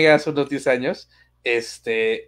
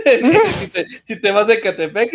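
high burst of laughter breaking the words; laughing; 6 semitones higher than usual. Real, not acted. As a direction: laugh through the start of it. Bursts out laughing mid-sentence.

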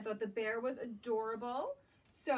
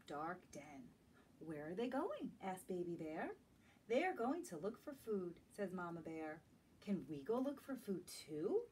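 gentle, lilting storybook read-aloud; reading to children; 3 semitones higher than usual. Acted. Teacher reading a picture book to young children, lilting. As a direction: draw little listeners into the story.

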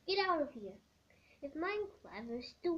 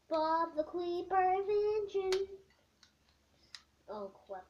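ordinary talk, nothing marked

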